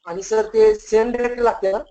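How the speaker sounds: ordinary delivery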